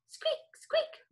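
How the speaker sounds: very high, soft squeaks; tiny mouse squeaks. Shown as a demonstration. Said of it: "Your turn, go".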